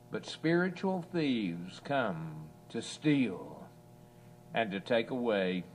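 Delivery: ordinary talk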